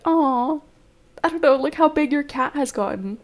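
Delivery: a touch of sweet cooing. Retold or imitated. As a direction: gush a little over the grown cat. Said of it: "it's so sweet to be like".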